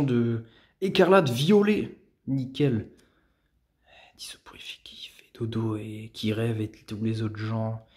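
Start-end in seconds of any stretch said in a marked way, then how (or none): 4.2-7.8 soft unvoiced whisper. Real, not acted. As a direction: breathe it out as a whisper. Reading card text aside.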